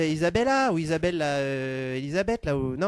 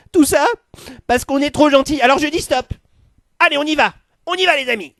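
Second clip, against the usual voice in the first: building to a furious, much louder harangue; ranting rally speech; 3 semitones higher, 8 dB louder than usual. Acted. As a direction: whip the crowd into a frenzy, louder and louder. Rabble-rousing speech to the crowd.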